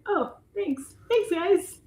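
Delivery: high, put-on sarcastic thanks; replying to backhanded fans, sarcastic and high. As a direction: thank them without meaning it.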